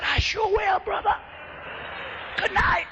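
high, strained, voiceless squeak; imitated shouted-out voice, cracking high; worn-out voice. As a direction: croak it out with the voice nearly gone. Preacher replies, also shouted out.

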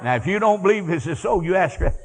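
ordinary delivery

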